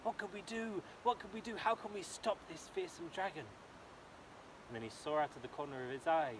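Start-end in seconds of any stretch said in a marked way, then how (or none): 0.0-3.4 higher, fretful wondering; a king at a loss; worried king. Acted. King frets about the dragon, lightly higher voice. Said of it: "he thought".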